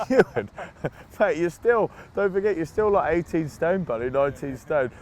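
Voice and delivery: high, loud laughing plea to a big mate; half-laughing, telling off a heavy friend; laughing protest. Retold or imitated. Player laughing at coach after neck wrench.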